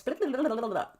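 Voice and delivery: mumbled blah blah with lips flapping; careless blah blah. Shown as a demonstration. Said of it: "If I move my lips".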